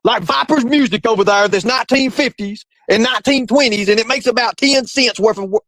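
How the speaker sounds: high, strained ranting impression; mock tirade held way up in pitch; Mark. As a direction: parody a host going off the scale. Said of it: "let me see if I can get this".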